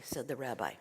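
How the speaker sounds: plain reading voice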